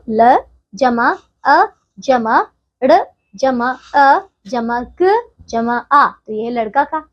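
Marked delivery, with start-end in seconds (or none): 0.1-6.1 very slow, each sound spelled out with pauses; slow sound breakdown. Shown as a demonstration. Teacher splitting a word into sounds for children, very slow. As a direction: spell लड़का sound by sound, slowly. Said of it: "लड़का में कौन कौन सी ध्वनियां आती है".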